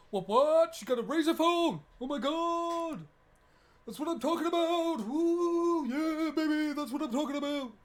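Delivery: high, loud hype-man yelling; hyped shouting. Acted. Celebrating the Razer phone, in high loud hype yells.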